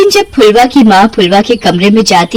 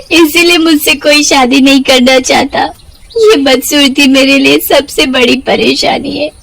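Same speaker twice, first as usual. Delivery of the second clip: put-on higher, tearful girl's voice; crying; 5 semitones higher than usual. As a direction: speak through tears. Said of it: "ऐसे क्यों रो रही हो". (रोते हुए बेटी माँ से कहती है)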